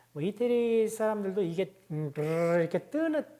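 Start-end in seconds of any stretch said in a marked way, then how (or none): 2.1-2.6 long rolled R buzz; tongue-tip trill. Shown as a demonstration. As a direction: flutter the tongue tip on the palate. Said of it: "떠는 발음이거든요". (혀끝을 길게 떠는 '드르르' 소리)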